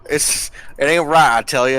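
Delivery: put-on preachy Southern old-timer; southern accent. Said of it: "How's my southern accent".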